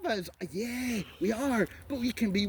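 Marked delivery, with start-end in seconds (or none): 0.0-0.3 mock whine. Mocking the other side's argument.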